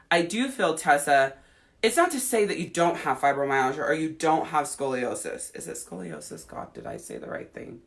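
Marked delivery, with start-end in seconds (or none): 5.5-7.8 unsure whisper under her breath; whispered to herself. Real, not acted. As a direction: whisper it to yourself, doubting. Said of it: "For fuck's sake, [name], get your shit together".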